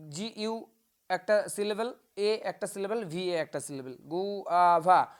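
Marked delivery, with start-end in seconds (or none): 3.9-5.1 গুয়াভা very slowly as gu-a-va; teacher sounding out গুয়াভা syllable by syllable; broken into syllables. Shown as a demonstration. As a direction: split গুয়াভা into three separate beats. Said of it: "এইভাবে কিন্তু ভাঙা".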